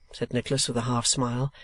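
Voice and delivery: usual speaking voice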